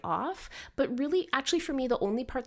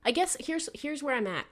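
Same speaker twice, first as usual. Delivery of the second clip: ordinary delivery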